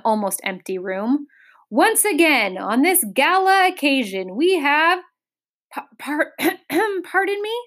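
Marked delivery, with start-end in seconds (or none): 1.7-5.0 high, loud proclamation; pompous kingly oratory; royal address. A narrator's reading. King addressing an almost empty hall. 5.7-7.5 high, hesitant interruption with throat-clearing; timid cough into a question; polite coughing. A narrator's reading. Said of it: "coughed Milo as politely as possible".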